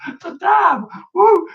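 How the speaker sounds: squeaky, playful exclamation; high silly voice. Acted. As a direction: pitch it way up for fun. Doing a dab dance move.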